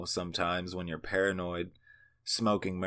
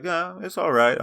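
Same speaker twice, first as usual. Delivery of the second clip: higher, louder offhand verdict; mock casual approval; 9 semitones higher, 6 dB louder than usual. Teen acting casual about the blunt, mock approval. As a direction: play it cool like a connoisseur.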